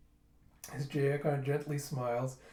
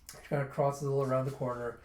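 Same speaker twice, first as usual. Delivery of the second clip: usual speaking voice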